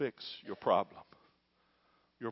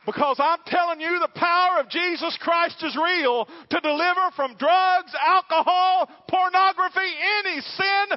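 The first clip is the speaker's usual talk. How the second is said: shouted high, slow pulpit climax; preaching at full force; 14 dB louder, slower than usual. Real, not acted. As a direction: roar it out to the congregation, slowly.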